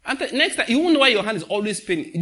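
ordinary talk, nothing marked

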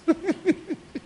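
high-pitched chuckle; amused laugh. Real, not acted. Preacher laughing at his own story, high amused chuckle.

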